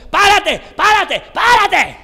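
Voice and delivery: very high, very loud repeated command; hammed-up shouted order to halt; mock traffic-officer shouting. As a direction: yell it like an over-the-top traffic cop. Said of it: "no le está gritando".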